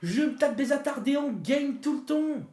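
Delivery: high, loud ranting; raging gamer. Real, not acted. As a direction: rant at his useless teammates. Ranting after losing the match. (joueur en rage qui peste fort)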